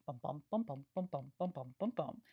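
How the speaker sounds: da-DUM beats, bum bum bum, with a steady iambic pulse; scanning the meter. Shown as a demonstration. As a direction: tap out the iambic rhythm on bum. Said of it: "if we assume that this line scans regularly".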